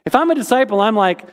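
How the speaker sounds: usual speaking voice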